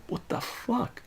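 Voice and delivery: hushed, disbelieving whisper; stunned whisper. Retold or imitated. Kid watching a classmate get bullied, stunned whisper. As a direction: whisper it as a shocked aside.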